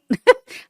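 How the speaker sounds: high burst of self-amused laughter; laughing at herself. Real, not acted. Host laughing at her own mispronunciation. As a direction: crack up at the slip.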